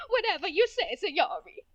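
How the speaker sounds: loud, high comic Italian; mock Italian accent. Streamer imitates the flip from girl to mascot, loud mock Italian accent. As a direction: replay the hammed-up Italian accent. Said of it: "and then".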